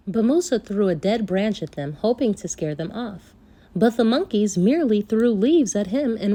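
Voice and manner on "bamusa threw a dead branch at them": ordinary delivery, nothing marked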